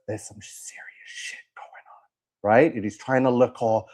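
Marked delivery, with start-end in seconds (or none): 0.1-2.1 very soft, voiceless copy of a whisper; mock whispering. Host imitating the actor's video for viewers, mock whispering. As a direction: whisper it like forbidden information. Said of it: "So he whispers".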